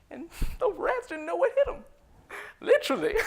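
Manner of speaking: high, loud, helpless laughter through the words; laughing hard. Acted. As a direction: crack up at your own story. Laughing at own tale.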